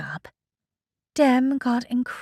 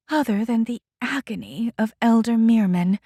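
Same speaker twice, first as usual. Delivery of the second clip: ordinary delivery, nothing marked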